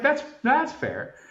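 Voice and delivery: slow squeaky "that's fair"; mock high-pitched concession. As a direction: go way up in pitch and draw it out. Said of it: "I was like".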